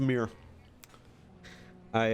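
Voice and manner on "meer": "mirror" shortened to "meer"; one-syllable Michigan pronunciation. Shown as a demonstration. Streamer demonstrating the local word, one-syllable "mirror". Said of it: "It's one syllable".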